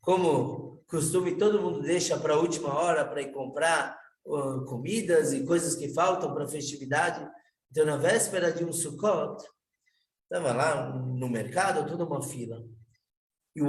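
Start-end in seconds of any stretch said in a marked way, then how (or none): none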